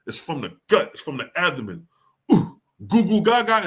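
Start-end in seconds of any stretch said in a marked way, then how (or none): 2.3-3.7 mock baby "goo goo gaga" from the gut; baby babble. Shown as a demonstration. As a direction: babble like an infant. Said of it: "is an abdomen put".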